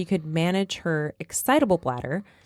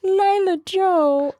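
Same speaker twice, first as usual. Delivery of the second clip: cooing; 13 semitones higher, 4 dB louder, much slower than usual. Real, not acted. Echoing the dog's name, cooing.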